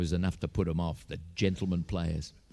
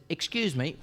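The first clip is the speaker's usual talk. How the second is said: lightly voiced stranger, pitched higher; stranger interrupting; 7 semitones higher than usual. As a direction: politely butt in, a little higher. Stranger steps out to interrupt poachers.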